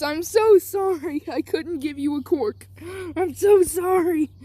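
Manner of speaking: a hint of a father crying as he apologises; mild acted sobbing. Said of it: "Deku's dad starts bawling".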